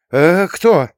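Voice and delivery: high, loud startled question; frightened cry. A narrator's reading. Proklova asks who is there, crying out frightened. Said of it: "испуганно загласила Проклова".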